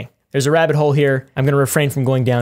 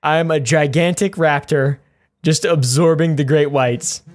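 normal talking voice

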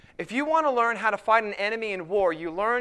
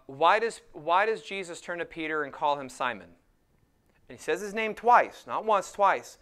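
normal talking voice

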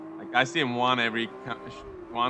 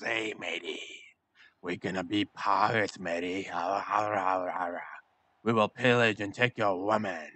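a lightly done pirate growl, a bit lower; pirating voice; 4 semitones lower than usual. Pretend. Gamer announcing Assassin's Creed in a hammed-up pirate voice. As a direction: play a jolly evil pirate, slightly lower. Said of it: "That's my pirating voice".

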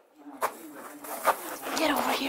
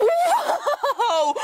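amazed shout, laughing; excited whoa; 7 semitones higher than usual. Real, not acted. Amazed inside glacier cave.

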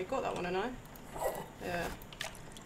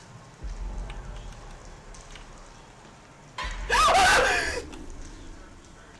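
frightened gasp. Real, not acted. Scare in horror game.

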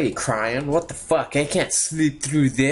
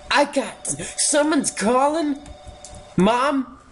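startled; 12 semitones higher than usual. Real, not acted. Phone rings in scary game.

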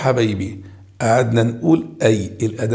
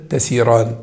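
ordinary talk, nothing marked

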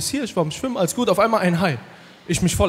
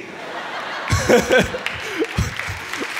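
breathy laugh; 4 semitones higher, 4 dB quieter than usual. Real, not acted. Laughs at own joke.

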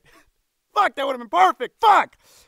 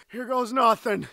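ordinary talk

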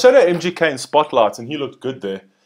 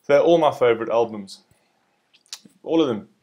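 ordinary talk, nothing marked

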